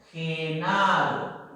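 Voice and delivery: Genaro drawn out very slowly, ending falling; slow model word; slow careful demo. Shown as a demonstration.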